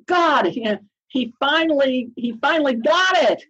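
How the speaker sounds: high-pitched, delighted relief; retold elation, pitch leaping up; elated. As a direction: relive the thrill of being understood at last. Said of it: "it made me elated".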